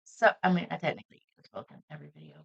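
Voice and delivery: ordinary talk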